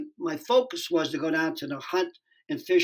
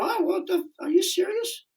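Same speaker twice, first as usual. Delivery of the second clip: much higher, playing his own naive shock; mock surprise; 13 semitones higher than usual. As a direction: pitch way up, wide-eyed innocent. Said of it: "I'm going".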